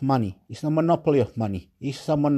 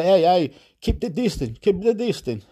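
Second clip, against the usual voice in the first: security guard order; 5 semitones higher than usual. Retold or imitated. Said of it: "They say".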